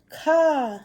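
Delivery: high, drawn-out caw. Acted. Crow calling to a fawn, exclaimed caw. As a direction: call it out as the crow. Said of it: "That's why I said it a little bit louder".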